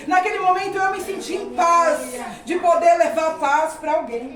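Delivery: preaching that builds to a loud declaration; fervent exhortation. Real, not acted. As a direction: urge the congregation to believe. Exhorts the congregation. (exortação fervorosa, crescendo em volume)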